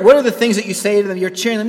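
ordinary talk

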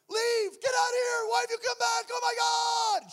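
high, loud frightened shriek; panicked scream. Retold or imitated. Ex-wife screaming at husband at her door, terrified. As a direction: scream him away from the door in terror. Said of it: "She's calling out to God".

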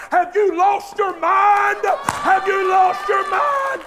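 slow, loud, high rebuke; incredulous preacher's shout; rebuking shout. Acted. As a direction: call them out sharply.

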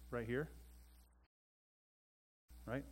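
ordinary talk, nothing marked

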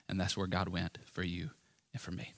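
breathy whisper with no voice. Acted. Pastor whispering to the congregation where God went, whispered. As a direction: let it fall to a whisper. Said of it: "whispered climax of meditation".

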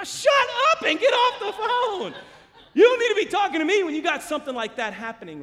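very high, loud comic yell; hammed-up scolding shout; exasperated outburst. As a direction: cut someone off, utterly fed up. Retold comic outburst cutting man off.

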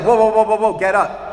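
mock-panicked get up; alarmed protest. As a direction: wave them off in mock panic. Everyone panicking at worship.

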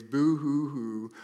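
mock sobbing boo hoo, slow; sarcastic pretend weeping; fake crying. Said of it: "rolled their eyes at me and said".

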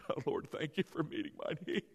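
soft, low, laughing through the words; quietly amused pretend prayer; laughing mock prayer. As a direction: fake a thank-you while chuckling. Mock half-hearted faking prayer.

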